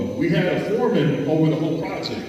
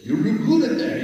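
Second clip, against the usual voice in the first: put-on higher, lightly accented broken English; 8 semitones higher, slower than usual. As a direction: mimic a non-native speaker. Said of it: "you know, broken English".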